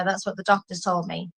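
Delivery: ordinary talk, nothing marked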